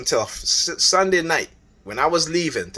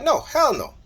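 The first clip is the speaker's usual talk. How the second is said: much higher hell no; emphatic refusal; 14 semitones higher than usual. Retold or imitated. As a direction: slam the door on it. Retold quarrel, flat refusal.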